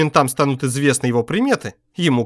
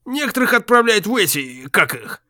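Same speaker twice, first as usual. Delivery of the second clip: grumbling; 4 semitones higher than usual. A narrator's reading. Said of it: "пробурчал он".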